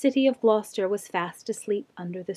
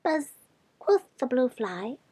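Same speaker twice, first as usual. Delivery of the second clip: bat voice; 4 dB quieter than usual. A narrator's reading. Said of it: "shrill voices".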